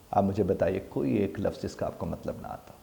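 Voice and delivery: normal talking voice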